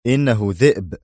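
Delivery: firm, curt correction; stern interruption. A narrator's reading. Brigadier interrupting his colleague, stern. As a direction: cut in with strict certainty. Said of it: "قاطعه أدهم في صرامة".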